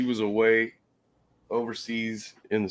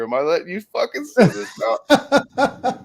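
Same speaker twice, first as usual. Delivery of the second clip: retold laughing teacher; 10 semitones higher, 4 dB louder than usual. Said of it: "He goes".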